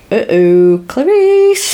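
teasing retold call, swooping way up; mock uh-oh call. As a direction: playfully imitate him, the name sung out very high. Villain's greeting to Clarice, retold.

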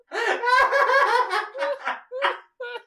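long high loud laughter; huge laugh. Real, not acted. Host cracking up at the handshake. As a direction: fall about laughing.

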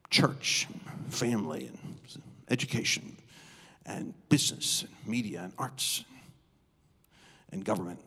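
hushed list. Real, not acted. Preacher listing the mind molders, hushed. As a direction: lay out each word like a secret.

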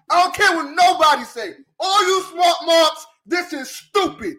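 normal talking voice

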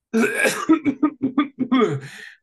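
loud forced cough; fake cough. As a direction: cough on purpose, hammed up. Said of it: "That was a fake cough".

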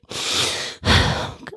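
long unvoiced sigh of relief; relieved sigh. Child calming down after mom's apology, long sigh. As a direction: let it all out in one breath. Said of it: "She's like".

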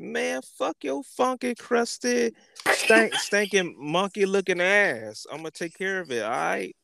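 high-pitched insult rant, slowing on stinking; high mock rant. High squealing rant telling off the neighbor.

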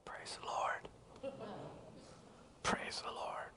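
tiny whispered imitation of a timid woman; feeble, voiceless praise; barely a peep. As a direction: mouth it in a faint whisper. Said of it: "not even a peep".

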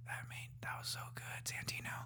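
barely audible whispered praise; hushed, breathy compliment; awed whisper. Acted. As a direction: whisper it as if deeply moved. Mock awed reaction to singing.

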